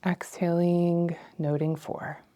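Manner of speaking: ordinary talk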